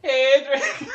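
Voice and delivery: loud, high mocking cry of a name; hammed-up dying woman calling out. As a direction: wail it loudly in mockery. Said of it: "making fun of the dying woman".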